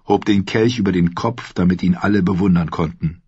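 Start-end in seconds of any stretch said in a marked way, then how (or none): none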